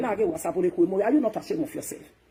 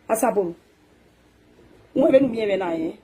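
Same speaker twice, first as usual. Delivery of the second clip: ordinary talk, nothing marked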